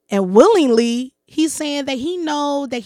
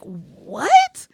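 high, louder disbelief; incredulous reply; 6 semitones higher, 6 dB louder than usual. Retold or imitated. Woman retelling her reply to the man who propositioned her, incredulous. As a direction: replay being thrown by his demand.

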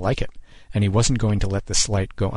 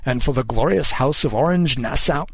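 higher, lightly pompous oratory; declaiming king; 4 semitones higher than usual. A narrator's reading. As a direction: declaim it as a monarch to the crowd. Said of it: "the king declaimed".

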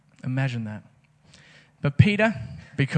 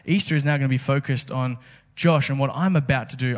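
ordinary delivery

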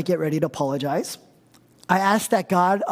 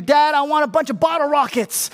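put-on higher-pitched begging child; a kid nagging dad for bottle rockets, higher and pleading; whiny kid; 7 semitones higher, 3 dB louder than usual.

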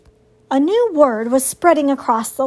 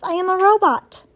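lightly voiced robot, pitched higher; higher, measured robot heroine; 7 semitones higher than usual. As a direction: answer evenly as a robot. Robot introduces what she is.

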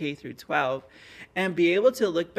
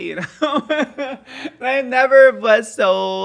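laughing out loud, much higher and louder; 10 semitones higher, 13 dB louder, much slower than usual. Real, not acted. Telling the listener about finally standing up, real laughing. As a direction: let the laugh break through the words.

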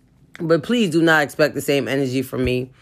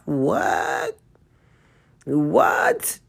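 incredulous. Real, not acted. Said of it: "this is craziness".

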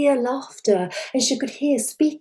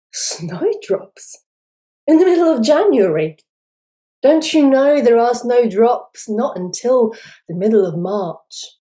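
louder, faster scoff of disbelief; incredulous old man; 7 dB louder than usual. Acted. Old man January mocks the girl, incredulous. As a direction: scoff at such a foolish wish.

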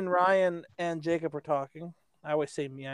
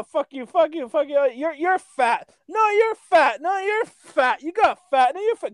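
put-on higher, louder childish yelling back and forth; hammed-up quarrel, much higher; mock shouting match; 8 semitones higher, 5 dB louder, slower than usual. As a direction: bicker like two kids screaming. Acted shouting match between friends.

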